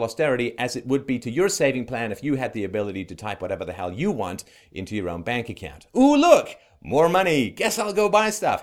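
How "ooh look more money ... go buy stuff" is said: high, loud gleeful exclaiming; mock delight. Commentator mimics someone typing money into their own account, mock delight. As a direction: put on giddy delight at sudden riches.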